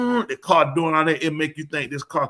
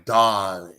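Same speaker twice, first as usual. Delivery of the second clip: sultry ad woman; 7 semitones lower than usual. Retold or imitated. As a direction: purr it like a glamorous smoker in a TV commercial.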